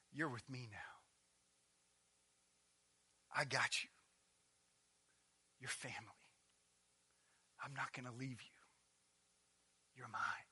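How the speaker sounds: soft, quiet, intimate promise; tender comfort. Acted. Jesus promising the thief he is family, tender and soft. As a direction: hold him close with your voice.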